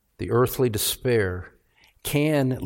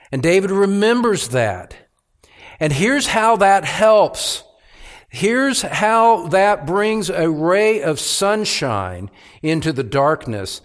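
higher and louder, driving sermon voice; preaching at full force; 8 semitones higher, 5 dB louder, slower than usual. Acted. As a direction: preach it hard, rising with conviction.

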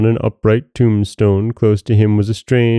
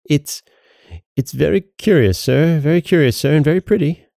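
high, halting, timid voice; anxious stammering placation; half dead with fright; 7 semitones higher than usual. A narrator's reading. Sexton humours the goblin, high frightened voice. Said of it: "half dead with fright".

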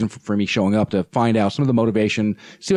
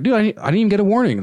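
indignant protest; 10 semitones higher, faster than usual. Retold or imitated. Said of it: "I was like".

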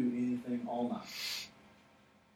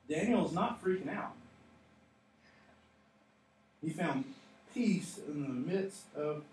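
ordinary delivery

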